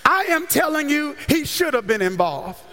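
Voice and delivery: ordinary talk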